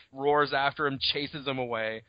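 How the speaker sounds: plain reading voice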